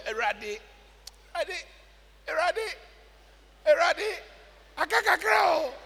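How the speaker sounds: high-pitched, repeated cries to God; mock desperate begging, with pauses between cries; acted pleading prayer. Preacher acting out a man crying to the Lord in trouble, high pleading.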